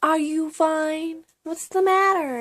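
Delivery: imitating people nagging with concern; concerned classmates. Imitating schoolmates asking if she's okay, high and mock-concerned. People would always ask me.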